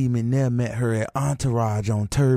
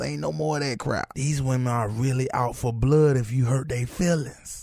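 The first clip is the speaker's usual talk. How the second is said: usual speaking voice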